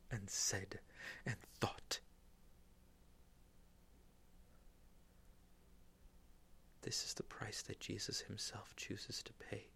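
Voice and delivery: hushed, remorseful whisper; whispered confession. Acted. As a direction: confess it under your breath.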